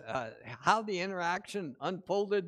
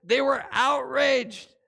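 outraged stressed, louder and slower; emphatic; 5 semitones higher, 8 dB louder, slower than usual. Shown as a demonstration. Preacher stressing servants' outrage, emphatic. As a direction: lean hard on outraged. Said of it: "take note".

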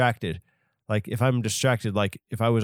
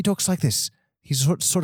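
higher mock English accent; higher-pitched comic English voice; second English voice type; 4 semitones higher than usual. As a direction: put on the other British guy, higher. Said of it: "And then the other guy".

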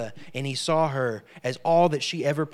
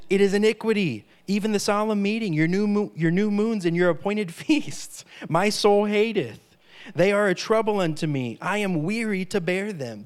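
scripture declaimed; 3 semitones higher than usual. Acted. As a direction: thunder the verses out like a prophet. Preacher reads God's words again.

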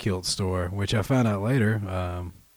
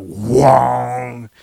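imitated breakdown sound; 8 dB louder than usual. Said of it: "There's just a big, like".

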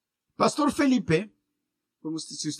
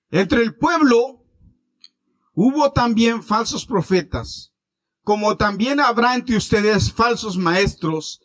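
solemn scripture reading; 4 semitones higher, 6 dB louder than usual. Acted. Reads scripture aloud solemnly.

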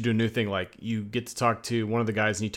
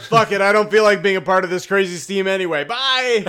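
higher, louder cheerful bailing out; carefree exit; 8 semitones higher, 5 dB louder than usual. Retold or imitated. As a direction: shout it like you're done caring. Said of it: "Arthur's like".

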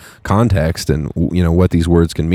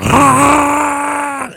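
E.T. freak-out sound; 3 dB louder than usual. Retold or imitated. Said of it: "crazy-ass voice".

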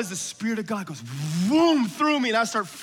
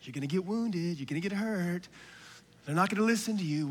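lightly voiced, softer, discouraging inner voice; inner doubting voice; 3 semitones lower, 7 dB quieter than usual. Preacher voices the doubt inside the listener, softly discouraging. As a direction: murmur it like the voice of doubt. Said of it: "This thing comes up into you and says".

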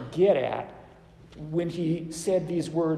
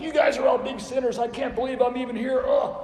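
mock accusing rant; 7 semitones higher, 3 dB louder than usual. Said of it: "where he's yelling at people like".

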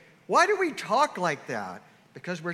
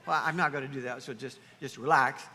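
ordinary talk, nothing marked

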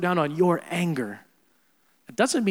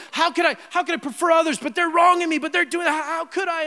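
mock whining; 11 semitones higher, 4 dB louder than usual. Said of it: "if you're like".

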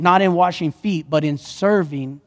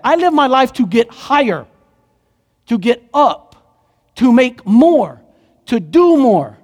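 emphatic self-mockery; 5 semitones higher, 5 dB louder than usual. Preacher mocks self-seeking life.

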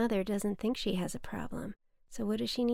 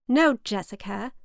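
louder, tight with restrained anger; through clenched teeth; 8 dB louder than usual. A narrator's reading. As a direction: grit it out, holding the anger in. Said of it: "she said through clenched teeth".

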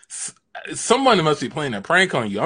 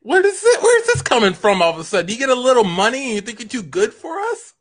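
indignant outburst; 10 semitones higher, faster than usual. Acted. Farmer confronts the horse.

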